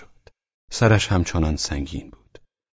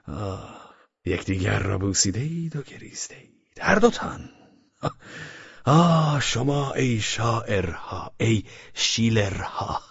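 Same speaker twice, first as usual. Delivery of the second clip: slow, softer, amused mocking talk; laughing through words; 5 dB quieter than usual. Velchaninov mocking the tipsy youth between laughs. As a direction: tease them while still laughing. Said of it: "از ته دل خندید".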